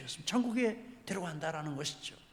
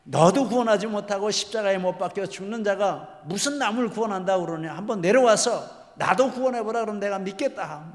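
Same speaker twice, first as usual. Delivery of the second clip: loud, raised-pitch taunting of a condemned robber; jeering shout; 4 semitones higher, 9 dB louder than usual. Retold or imitated. Robber mocking Jesus from the next cross, jeering shout. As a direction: shout mockingly, as if cursing him. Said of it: "이렇게 소리를 지릅니다".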